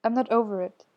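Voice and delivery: ordinary delivery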